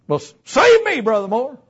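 high, loud mock plea; acted desperate appeal; urgent plea. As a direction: cry out for help, then ease down. Acted man pleading to be saved.

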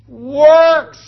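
"works" loud and drawn out, with a long final s; stress on plural. Shown as a demonstration. Preacher stressing the plural to his congregation, "works" stretched out. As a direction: hit "works" and hold the s. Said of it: "Notice the plurification of the word work".

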